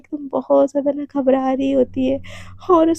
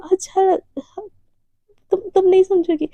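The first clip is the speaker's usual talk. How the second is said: tearful; 5 semitones higher than usual. Acted. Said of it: "Devika थोड़ी सी emotional हो जाती है".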